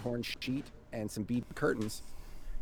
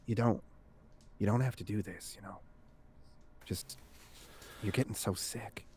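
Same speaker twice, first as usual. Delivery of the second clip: soft, halting plea, fading quieter; gentle concern; 8 dB quieter than usual. Acted. Husband urging his sick wife to stop, soft concern. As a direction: hold back and urge softly, with pauses.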